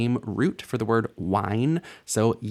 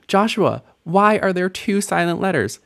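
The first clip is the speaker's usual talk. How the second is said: higher-pitched mock student's protest; questioning student; 8 semitones higher, 3 dB louder than usual. Student challenging teacher Joshua about two silent letters, mock questioning. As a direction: put on a student's high, querying voice.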